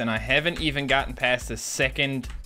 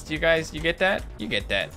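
usual speaking voice

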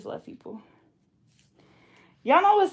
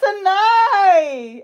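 high, loud emotional cry of a name; a loud, moved shout; touched cry; 11 semitones higher, 14 dB louder than usual. Real, not acted. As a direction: call her name, overwhelmed. Touched by a longtime fan.

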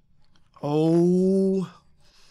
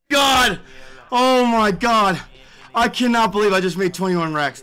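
normal talking voice

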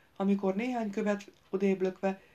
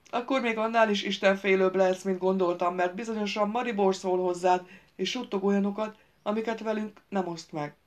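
sarcastic mockery; 3 semitones higher, 7 dB louder than usual. A narrator's reading. Gaunt mocking Miron's piety.

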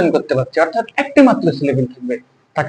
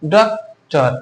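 "Doctor" very slowly broken into ডক and টর; two separate beats for "doctor", teaching the syllables; split into syllables; much slower than usual. Shown as a demonstration. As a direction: leave a long gap inside "doctor", after ডক. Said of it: "একবারে পাচ্ছি না".

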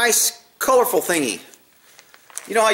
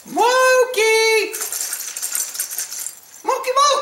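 high falsetto pet call; calling the cat; slower than usual. Real, not acted.

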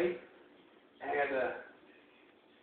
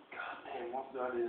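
very soft, low self-talk; hushed, stumped muttering; pondering to himself; 8 semitones lower, 17 dB quieter than usual. Retold or imitated. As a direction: think aloud under your breath.

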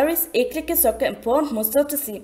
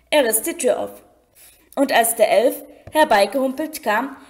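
usual speaking voice

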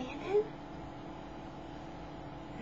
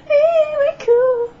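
excited squeal; 12 dB louder than usual. Real, not acted. Excited at filter.

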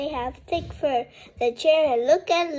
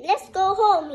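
higher, louder happy call; eager to leave; relieved call; 6 semitones higher, 6 dB louder than usual. A narrator's reading. Clown in the van, relieved and eager.

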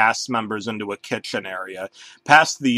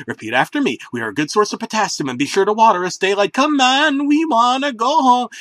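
fast, higher, louder sing-song; baby CD voice; 9 semitones higher, 4 dB louder than usual. A narrator's reading. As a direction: chirp like a kids' CD. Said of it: "I screamed".

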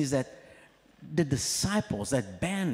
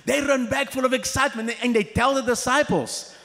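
ordinary talk

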